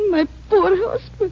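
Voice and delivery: tearful cry, voice high and thin. Acted. Widow weeping for her murdered husband. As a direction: break down over the lost husband.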